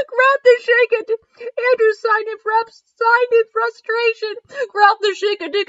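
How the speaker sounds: high, squeaky voice fighting giggles; laughter-strained, high read; reading through laughter. Real, not acted. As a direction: struggle to get the sentence out laughing. Resumes reading while still laughing.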